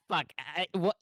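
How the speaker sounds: ordinary talk, nothing marked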